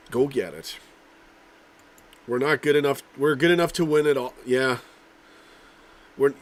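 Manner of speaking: ordinary talk, nothing marked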